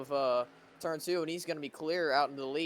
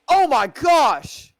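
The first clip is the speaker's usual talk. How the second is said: huge, high, slow cry of shock; shocked shout; 13 semitones higher, 17 dB louder, much slower than usual. Real, not acted. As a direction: scream it as the crash happens. Sudden crash seen live.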